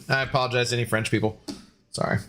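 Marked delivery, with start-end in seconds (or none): none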